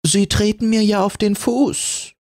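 high, dainty voice; a prim little lace scolding; delicate complaint. Acted. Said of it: "mit zarter Stimme".

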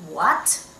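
WHAT sliding up from bottom to top; rising second tone. Shown as a demonstration. Teacher likening second tone to English, rising What. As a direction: lift What like a surprised question. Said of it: "second tone from bottom to the top".

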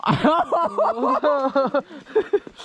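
bright laugh rising up; amused laugh. Real, not acted. Cười vì câu hỏi. (tiếng cười thích thú)

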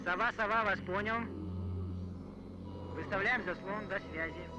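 answering over a walkie-talkie; crackly radio reply. Real, not acted. Radio reply, patrol boat.

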